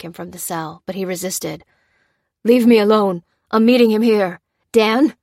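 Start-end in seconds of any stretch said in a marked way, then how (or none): 2.4-5.2 loud, defiant shout; resisting cry. A narrator's reading. Feverish Adrian refusing to leave, loud shout. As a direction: shove her off and call out. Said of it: "cried Adrian".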